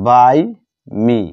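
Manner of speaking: ordinary talk, nothing marked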